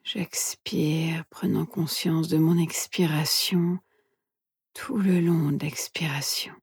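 soft, hushed meditation guide; guided meditation whisper. Acted. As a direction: whisper gently, leaving long pauses.

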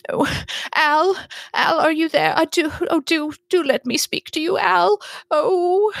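high-pitched pleading woman, acted near sobbing; tearful Penelope. Séance guest calling out to her dead Al, high and tearful. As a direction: beg in a high, overwrought voice. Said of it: "she just starts sobbing".

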